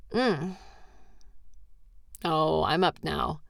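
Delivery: normal talking voice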